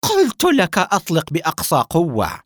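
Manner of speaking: high, loud, insistent order; edgy, angry scream. A narrator's reading. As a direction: shout the order down at him. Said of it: "بعصبية وصرخ فيه". (صراخ عصبي آمر)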